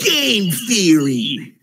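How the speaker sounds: hammed-up slow catchphrase; mock announcer. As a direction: stretch it like a YouTube intro. Mocking YouTube catchphrase.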